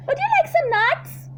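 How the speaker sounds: very high squeaky monkey; chirpy monkey. Acted. Monkey offering the crocodile nuts, high chirpy.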